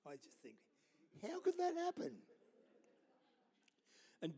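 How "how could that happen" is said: incredulous. Retold or imitated. Incredulous inner question.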